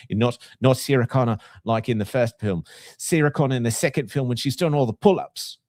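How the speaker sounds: put-on New Zealand accent. Host quoting the lady about Sarah Connor, New Zealand accent. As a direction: raise it into New Zealand vowels. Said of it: "New Zealand accent, though, is that lady".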